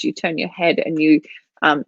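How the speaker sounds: usual speaking voice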